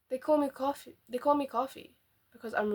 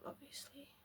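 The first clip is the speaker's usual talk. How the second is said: whisper; 4 semitones higher, 22 dB quieter than usual. Real, not acted. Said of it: "whispered aside".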